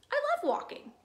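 very high, loud enthusiasm; delighted beaver. Acted. Beaver thrilled about walking with Bird, high excited voice. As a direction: squeal it with glee.

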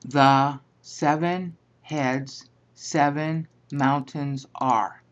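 very slow, phrase by phrase; word-for-word translation. Shown as a demonstration. Teacher giving the literal translation of the Greek, slow and deliberate. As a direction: spell out the literal word order slowly.

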